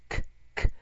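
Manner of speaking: whispered "く", breath only, no voice; "く" with only breath, throat still; 無声音のく. Shown as a demonstration. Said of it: "やってごらん". (息だけで「く」)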